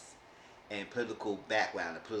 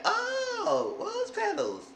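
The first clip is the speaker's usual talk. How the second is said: high falsetto swooning man; hammed-up dreamy delight; squeaky impressed gasp at the petals; mock Ray delighted; 3 dB louder, much slower than usual. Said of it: "he said".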